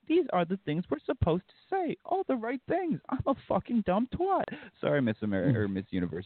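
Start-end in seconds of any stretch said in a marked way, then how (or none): 0.1-4.4 high, airy mock beauty queen voice; ditzy pageant parody, pitched up; mocking pageant contestant. Mocking Miss Universe contestants for friends, high ditzy voice. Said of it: "they're like".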